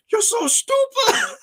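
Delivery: a hammed-up scream, very high, slow and laughing; mock scream. Professor venting at student emails, a mock laughing scream. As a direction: wail it at the screen, half laughing. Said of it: "I just wanna scream at the computer".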